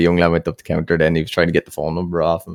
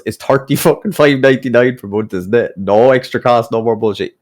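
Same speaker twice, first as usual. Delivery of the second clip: loud, higher-pitched retold rant; mock telling-off; 5 semitones higher, 5 dB louder than usual. Customer laying down the price to a young sales lad, mock telling-off. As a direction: imitate an indignant customer ripping into someone. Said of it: "he must have ripped into the poor young lad".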